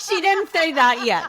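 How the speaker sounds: speaking through a real laugh; amused, giggling reply. Real, not acted. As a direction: laugh out the words. Laughing at a friend's joke.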